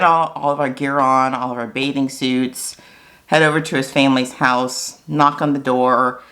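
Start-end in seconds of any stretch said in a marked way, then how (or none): none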